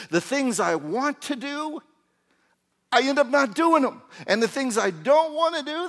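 loud, high cry of frustration; impassioned, full-voiced preacher's wail; Paul's anguished lament. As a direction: cry out in torment, as if driven crazy.

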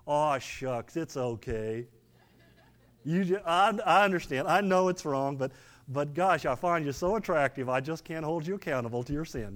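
indulgent grandfatherly God. Pretend. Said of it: "this grandfatherly approach".

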